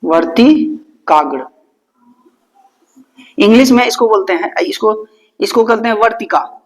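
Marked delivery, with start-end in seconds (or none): 0.0-1.5 वर्तिकाग्र drawn out slowly, syllable by syllable; slow spelled out. Shown as a demonstration.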